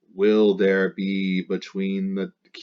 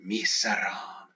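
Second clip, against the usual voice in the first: miseram whispered softly and slowly, voiceless; 4 dB quieter, slower than usual. Shown as a demonstration. Teacher whispering miseram to stress it. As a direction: breathe out miseram as a hush.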